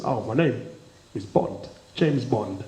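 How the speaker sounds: low, quiet, suave Bond delivery; classic Bond line. Retold or imitated. Comic voicing James Bond at the casino, low and quiet. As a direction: purr it low like the real Bond. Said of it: "That's what he says".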